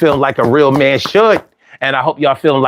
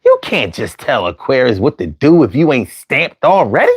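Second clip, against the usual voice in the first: normal talking voice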